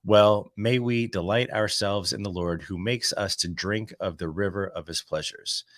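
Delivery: ordinary talk, nothing marked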